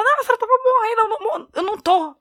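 high, panicky retold protest; imitated desperation. Aunt and revived grandma argue over her dying, imitated desperation. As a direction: act out frantic disbelief high up. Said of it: "que ela desesperou".

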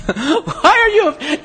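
laughing in disbelief. Real, not acted. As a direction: laugh at the question. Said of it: "now that's a silly question".

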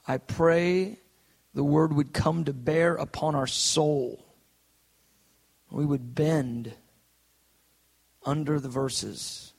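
slow, pausing prayer from the pulpit; earnest prayer. Real, not acted. Pastor asking God for revelation, earnest slow prayer. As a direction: pray it slowly, letting each phrase rest.

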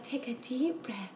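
soft, slow whispered instruction; hushed guided breathing cue; soothing whisper. Retold or imitated. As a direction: whisper it like a sleep hypnotherapist. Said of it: "and she's like".

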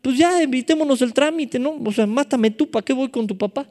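high-pitched, colloquial acted protest; David pleading. Preacher acts David protesting to his friend Jonatán, high-pitched plea. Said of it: "Todavía le dijo a Jonatán".

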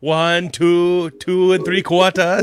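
slow countdown in mock Jamaican; stretched-out put-on Jamaican accent; Shrunken Head's Jamaican accent. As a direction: imitate a movie character in Jamaican accent. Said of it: "based on the Shrunken Head".

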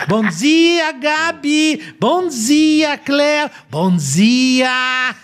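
high, sing-song cheery morning greeting; imitating Kelly Matos. Radio colleague mocking a morning host's over-happy greeting, high and sing-song. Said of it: "Parece uma alegria".

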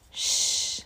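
soft secret shush; shushing whisper. Acted. Keeping the genius secret.

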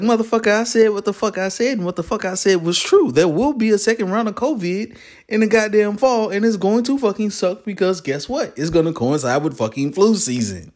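a hint of a gutsy lady who won't back down; fed-up older lady. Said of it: "She was like".

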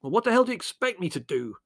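high, exasperated snarl. A narrator's reading. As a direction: snarl back at the blame. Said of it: "snarled Larry".

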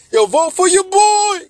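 high, loud, stretched campaign holler; hammed-up rapper sign-off. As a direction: shout it to a concert crowd. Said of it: "Jay-Z ends his concert like".

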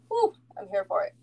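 ordinary talk, nothing marked

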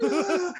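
high laughing imitation scream; mock scream. Storyteller imitating Salty's reaction, mock scream. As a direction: scream like your friend, half laughing. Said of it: "he's screaming".

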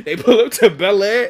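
ordinary talk, nothing marked

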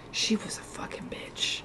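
hushed, unvoiced whisper. Real, not acted. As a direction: whisper it like a secret. Badmouthing a hated teacher.

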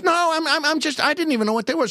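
put-on high, rapid stammering boy; Lou Costello impression. Hustler pleads ignorance, fast high boy voice. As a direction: stumble innocently over the words, high and quick. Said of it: "Bud and Lou were so good".

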